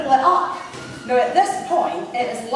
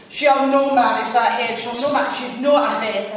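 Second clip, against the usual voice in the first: loud Coatbridge caller; Coatbridge accent; 6 dB louder than usual. Retold or imitated. Caller objecting to the menu, loud Coatbridge accent. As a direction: imitate a Coatbridge woman, loudly objecting. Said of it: "in her best Coatbridge accent".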